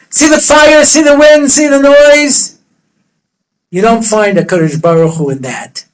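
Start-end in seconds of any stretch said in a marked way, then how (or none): 0.1-2.6 building emphasis. Acted. God points out the big show.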